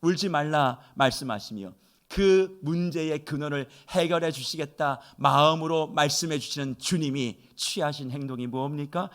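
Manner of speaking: ordinary talk, nothing marked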